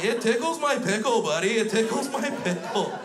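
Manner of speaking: high, loud toddler impression; gleeful little kid. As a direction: squeal it like a delighted four-year-old. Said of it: "He's just sitting there like".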